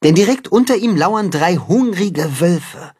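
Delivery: ordinary delivery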